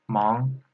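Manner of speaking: "Mang" rising in second tone. Shown as a demonstration. Teacher showing learners "Mang" in second tone. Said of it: "second tone".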